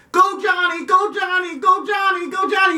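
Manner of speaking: high mock chant of go Johnny; cheering parent. Parent yelling for Johnny at the game, high mock chant. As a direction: cheer like an over-eager parent.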